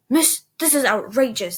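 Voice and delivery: loud, indignant scolding; scowling outrage. A narrator's reading. Servant scolding Mary for the snowball, indignant. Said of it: "scowling at Mary".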